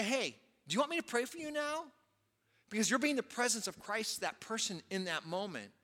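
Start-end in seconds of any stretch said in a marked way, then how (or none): 0.0-1.9 demo offer. Shown as a demonstration. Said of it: "just say".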